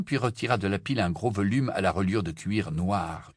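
ordinary delivery, nothing marked

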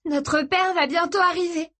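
high, breathless warning; souffle coupé. A narrator's reading. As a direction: blurt it as if winded, pitched well up. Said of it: "le souffle coupé". (voix haute, le souffle coupé)

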